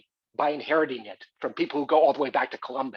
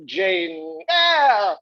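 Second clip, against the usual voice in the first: drawn-out, much higher "Jane Eyre" savoured slowly; grand title announcement; 11 semitones higher, 5 dB louder than usual. Acted. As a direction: stretch out "Jane Eyre" like a grand unveiling, pitched high and slow.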